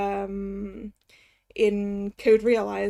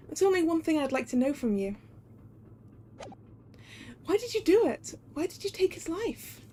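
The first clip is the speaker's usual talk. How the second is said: put-on higher, softly accusing young assistant; Susato; 5 semitones higher, 4 dB quieter than usual. Assistant asking why he took his life, higher accusing voice. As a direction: question him in a higher character voice. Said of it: "It's Susato".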